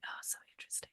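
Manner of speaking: breathless hushed whisper; awed whisper. Retold or imitated. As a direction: whisper it in fascinated awe. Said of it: "I'd be like".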